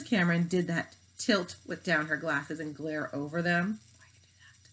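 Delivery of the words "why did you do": hushed whisper with no voice; a scared, hushed classroom whisper. Acted. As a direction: whisper it so the teacher won't hear. Whispering during the class glare.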